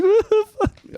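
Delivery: very high squeaked outrage; high mock whine of disbelief; kid's squeaky outrage. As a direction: squeal it out like a shocked kid. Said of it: "He's like".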